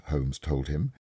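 usual speaking voice

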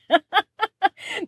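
a real, high little giggle; quick delighted chuckle; amused laugh. Real, not acted. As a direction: laugh at how grumpy people act. Laughs mid-explanation.

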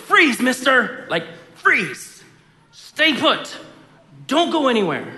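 high, loud mock-cop command; mock stern freeze. Parent playfully ordering kid to freeze, high and loud. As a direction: play a cop freezing a suspect. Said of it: "imaginary force field of".

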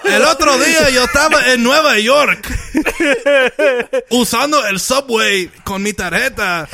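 high, excited Latin showman; Stefan Miguel voice. Pretend. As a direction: hype up the crowd like a Latin singer. Plays Stefan Miguel on stage.

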